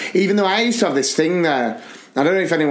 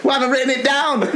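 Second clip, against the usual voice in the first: laughing self-mock; 8 semitones higher, much faster than usual. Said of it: "I'd just be like".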